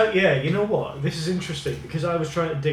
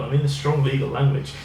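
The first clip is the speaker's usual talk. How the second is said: ordinary talk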